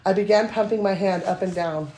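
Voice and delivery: steady read-aloud narration; story reading. Acted. Reading her steamy story to podcast cohosts, even narration. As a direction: read the romance scene out to the room.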